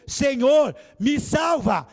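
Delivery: loud desperate cry of a sinking man; cry for help. Retold or imitated. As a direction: yell for rescue while going under.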